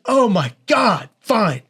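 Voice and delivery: loud, high, drawn-out exasperation; exasperated surrender. Retold or imitated. Woman agreeing to go on the date anyway, exasperated. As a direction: give in loudly, stretching the words.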